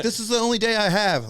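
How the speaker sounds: high, loud whine; high pleading whine; high whiny plea. Retold or imitated. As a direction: whine it like an entitled guy. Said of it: "he's like".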